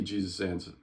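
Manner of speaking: ordinary delivery, nothing marked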